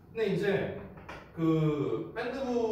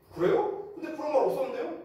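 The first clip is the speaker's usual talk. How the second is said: higher, pointed sassy reply; cheeky talk-back; 10 semitones higher than usual. Retold or imitated. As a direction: talk back to the scary senior. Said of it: "성격이 저도 이제 좀 있다 보니까". (당돌하게 높여 따지듯)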